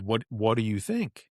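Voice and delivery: ordinary talk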